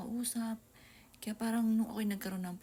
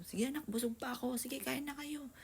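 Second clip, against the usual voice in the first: higher, lightly cheerful mock reassurance; pretend cheerful mother; 6 semitones higher than usual. Mother retelling her pretend to hungry kids, lightly cheerful. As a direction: fake being full so the kids eat. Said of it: "nagkukunwari ako".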